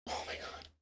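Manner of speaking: breathless whisper of disbelief; barely voiced, to himself; whispered oh my God. Real, not acted. Exasperated aside.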